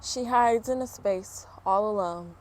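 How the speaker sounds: ordinary delivery, nothing marked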